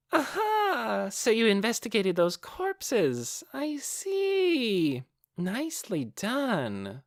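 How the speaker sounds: put-on high, teasing young woman's voice; bright, mocking sing-song delight; a high, teasing, toying voice; playful Clementine.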